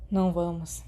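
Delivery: ordinary delivery, nothing marked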